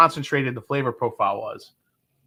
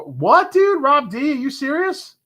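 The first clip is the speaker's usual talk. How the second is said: squeaky high disbelief, much higher and louder; delighted what-are-you-serious shock; real surprised squeal at a generous offer; 11 semitones higher, 3 dB louder than usual. Real, not acted. Viewer offers a bonus bottle.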